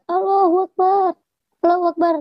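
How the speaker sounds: sustained, high-pitched re-enacted cry; retold cry for help. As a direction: imitate calling out for rescue, without full volume. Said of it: "Saya berteriak".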